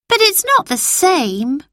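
put-on high, slow little girl complaining; a small girl sulking, dragging the words. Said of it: "said Phyllis".

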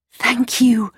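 high, grateful exclamation; grateful cry. A narrator's reading. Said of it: "cried Gerda".

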